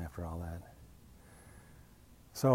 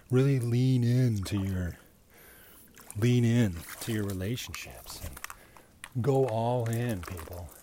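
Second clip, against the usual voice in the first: sarcastic; 2 semitones higher, 7 dB louder than usual. Acted. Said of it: "without the tone of sarcasm in my voice".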